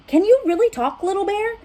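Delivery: high, eager amazed question; excited crowd. Acted. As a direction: ask all at once, thrilled. Animals crowd around amazed.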